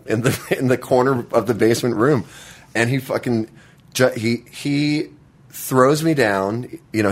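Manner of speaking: usual speaking voice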